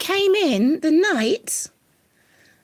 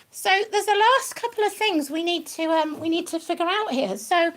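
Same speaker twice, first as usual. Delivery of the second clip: normal talking voice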